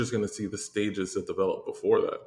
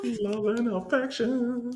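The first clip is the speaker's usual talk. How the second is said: teasing sing-song; slower than usual. Acted.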